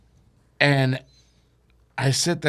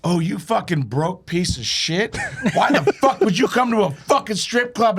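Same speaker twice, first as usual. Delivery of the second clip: mock loud heckle; 8 semitones higher than usual. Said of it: "she loudly says".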